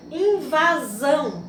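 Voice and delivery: slow, emphatic invasão with the last syllable held; stress on last syllable. Shown as a demonstration. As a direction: sound out invasão, stressing the final são. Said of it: "forte, né? Sílaba tônica".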